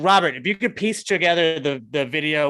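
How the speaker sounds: normal talking voice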